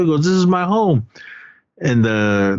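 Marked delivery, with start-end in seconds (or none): 0.2-1.1 high-pitched retold protest; mock protest. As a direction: imitate someone standing his ground, pitched up. Retold protest about his home.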